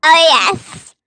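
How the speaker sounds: chipmunk voice. Pretend. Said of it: "you just sound like you're on helium".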